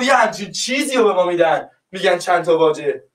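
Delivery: normal talking voice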